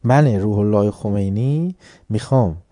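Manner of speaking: normal talking voice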